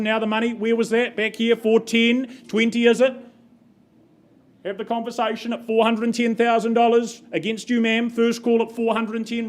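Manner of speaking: brisk rhythmic auctioneer calling bids; auction bid spotter. Real, not acted.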